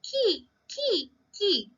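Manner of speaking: high squawky kee kee; parrot call. Shown as a demonstration. Teacher showing children a parrot's call, high squawk. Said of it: "What sound does the parrot make?".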